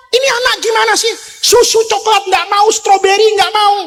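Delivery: high, fast flustered king; panicked Pharaoh. Acted. Pharaoh frets that the baby refuses every milk, high and fast. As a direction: rattle it off in a fluster. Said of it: "panik Firaun".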